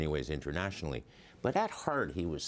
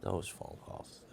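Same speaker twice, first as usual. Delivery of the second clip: slow, softer near-whisper repeat; breathy hushed repeat, slow and softer; soft wistful echo; 3 semitones higher, 6 dB quieter, slower than usual. Real, not acted. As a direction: let it fade as you remember him. Fond memory repeated quietly.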